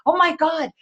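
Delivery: high, thrilled "Oh my God"; delighted gasp. Retold or imitated. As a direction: squeal it like a kid seeing the Care Bears. Retold delight at Care Bears.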